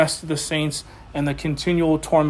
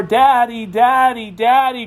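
imitated child's cry; 5 semitones higher, 3 dB louder, slower than usual. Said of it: "That's the same cry that you're gonna hear".

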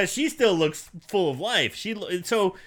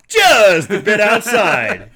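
announcer quote; 8 dB louder, slower than usual. Retold or imitated. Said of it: "reference Major League".

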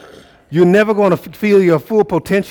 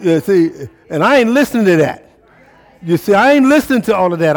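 higher and louder, building the call; full-force preaching; 6 semitones higher, 4 dB louder than usual. Acted. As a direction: lift them up from the pulpit, voice soaring.

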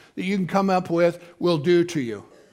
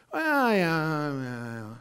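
mock dismissive mumble; 4 semitones lower, 6 dB quieter, much slower than usual. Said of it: "things that God wants us to be involved in".

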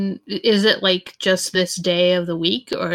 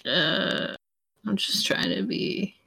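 stoner voice; 2 semitones lower, 7 dB quieter than usual. Real, not acted. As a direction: trail off like a stoner. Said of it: "now I sound like a stoner".

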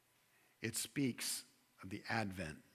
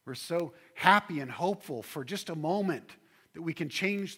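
louder and higher, full-force preaching; rising preaching; 6 semitones higher, 7 dB louder than usual. Acted. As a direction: build the sermon to a ringing climax.

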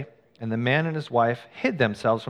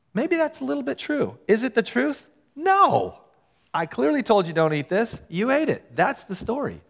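much higher, pressing voice; emphatic rebuttal; 10 semitones higher than usual. Acted. Preacher answering the excuse with God's firm reply, emphatic. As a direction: drive the point home, rising.

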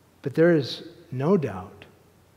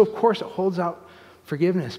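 normal talking voice

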